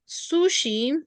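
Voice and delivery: slow, clear teacher model; deliberate classroom model; slow model sentence. Shown as a demonstration. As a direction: spell it out slowly for the class.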